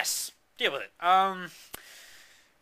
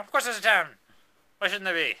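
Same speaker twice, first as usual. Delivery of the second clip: higher and louder, mock offended; indignant; 4 semitones higher, 5 dB louder, faster than usual. Real, not acted. Storyteller defending his made-up town, indignant and louder. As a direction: bristle as if the question were absurd.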